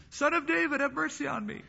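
high-pitched plea at ordinary volume; crying out. Retold or imitated. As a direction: call out for mercy in a high, restrained voice. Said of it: "he cries louder".

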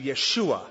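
"Yeshua" as yeh-SHOO-ah; Hebrew pronunciation. Shown as a demonstration. Preacher giving the contracted Hebrew name, Hebrew pronunciation of "Yeshua". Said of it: "The Hebrew pronunciation is Yeshua".

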